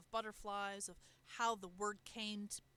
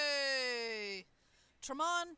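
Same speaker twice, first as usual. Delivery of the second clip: long drawn-out hey, then the name higher; calling out; 3 semitones higher, 6 dB louder, much slower than usual. Acted. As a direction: holler to get their attention. Calling out to a character.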